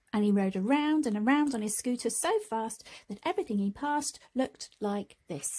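ordinary delivery, nothing marked

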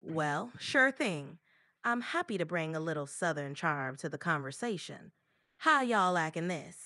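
Southern US charm accent; American Southern accent. Shown as a demonstration. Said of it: "speak with an American Southern accent".

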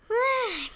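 soft falling sleepy breath; sleepy snore-sigh. Acted. Winnie sleeping, a soft snoring sigh. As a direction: breathe out like drifting asleep.

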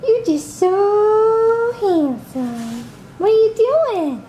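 high, slow drawn-out praise; slow cooing. Real, not acted. Mom telling baby he's handsome, slow high coo.

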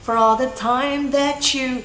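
slow, "that you" blended into "tha-chu"; linked sounds. Shown as a demonstration. Teacher shows learners linking, "that you" as "tha-chu". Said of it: "âm t và âm giờ".

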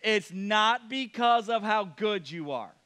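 each word laid down slowly; slow, pointed, deliberate; slow emphatic reminder. Shown as a demonstration. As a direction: spell it out slowly for them. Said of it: "Just in case you forgot".

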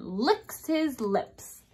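slowed, drawn-out spooky finish; slow ominous ending. Acted. Teacher ends story for class, slow and teasing. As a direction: stretch it out with relish.

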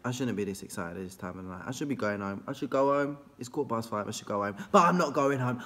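ordinary talk, nothing marked

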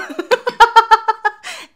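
loud, high burst of laughter; high cackle. Real, not acted. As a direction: crack up at yourself, high and loud. Laughs at own accent.

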